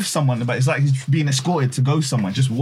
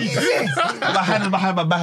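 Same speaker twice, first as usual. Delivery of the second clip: laughing; 6 semitones higher than usual. Real, not acted. Laughing retelling toilet moment.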